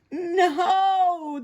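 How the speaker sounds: high, loud, stretched cry; drawn-out cry of alarm; panicked. Acted. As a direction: wail it as something falls toward you. Prairie dogs flee falling Big Bark.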